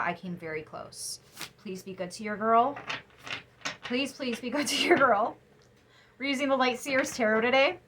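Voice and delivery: ordinary talk, nothing marked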